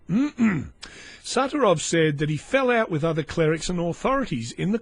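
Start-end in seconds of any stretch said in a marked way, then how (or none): none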